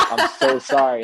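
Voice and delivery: high, loud apology with a laugh underneath; apologetic. Retold or imitated. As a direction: plead sorry, half laughing as you retell it. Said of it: "I felt bad".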